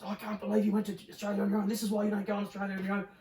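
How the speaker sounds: high, fast mock scolding; scolding girlfriend. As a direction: rattle off the nagging like the girlfriend. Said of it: "she was".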